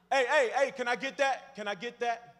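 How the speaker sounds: loud, high hollering for change; street panhandler. Acted. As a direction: imitate a beggar calling insistently to passersby. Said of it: "You know what it sounds like".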